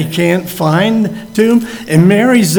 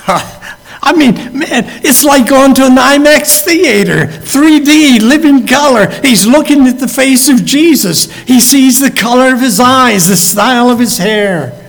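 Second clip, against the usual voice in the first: excited; 7 semitones higher than usual. Real, not acted. Preacher marveling to the congregation at restored sight, excited. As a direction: let the amazement burst out.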